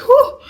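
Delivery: high loud panicked whoo; panicked whoo at the gush. Retold or imitated. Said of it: "I'm like".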